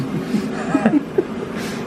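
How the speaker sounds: airy wheezing laugh up high; high breathy laugh. Real, not acted. Wheezing with laughter at his friend's teasing. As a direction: let the laugh go thin and high.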